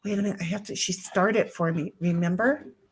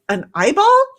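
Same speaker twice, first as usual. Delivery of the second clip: mock surprise; 6 semitones higher, 9 dB louder than usual. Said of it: "Ghost is like".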